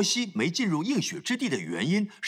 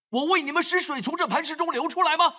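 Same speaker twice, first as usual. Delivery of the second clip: angry rebuke; 8 semitones higher, faster than usual. Retold or imitated. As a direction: challenge them with anger. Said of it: "他呈现的神很生气". (摩西怒问百姓)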